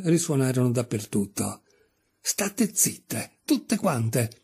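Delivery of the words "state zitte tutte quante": tiger lily shouting. Acted. Lightly voiced tiger lily silencing chattering daisies, mild shout. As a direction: snap at the daisies to hush. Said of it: "gridò il giglio tigre".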